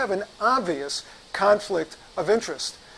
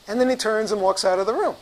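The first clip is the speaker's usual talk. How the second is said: normal talking voice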